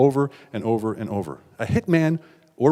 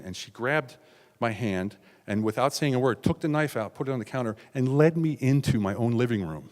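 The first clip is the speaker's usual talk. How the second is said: ordinary talk, nothing marked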